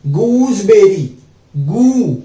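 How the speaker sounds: "Gooseberry" stretched out slowly, syllable by syllable; slow teaching drawl. Shown as a demonstration. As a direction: spell out "gooseberry" slowly for the class. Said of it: "जी double O". (धीरे-धीरे खींचकर बोला गया "gooseberry")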